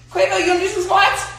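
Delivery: high, excited invitation to a dog; pitched right up for the dog; dog-talk voice. Real, not acted. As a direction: coax the dog in a high, playful voice. Talking playfully to the dog.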